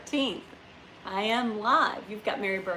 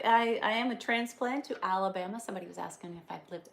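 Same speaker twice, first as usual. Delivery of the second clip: ordinary talk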